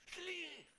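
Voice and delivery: high falling "slee" like a whistle; high whistle call. Shown as a demonstration. Showing chat how he stops his son, high whistle "slee". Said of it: "I'd go like this".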